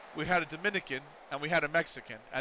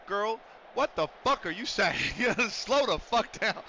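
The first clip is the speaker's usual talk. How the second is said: higher, slowed-down sassy scolding; exasperated sass; 5 semitones higher than usual. Retold or imitated. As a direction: tell her friend to slow down, hammed-up. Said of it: "She'd just look at her and go".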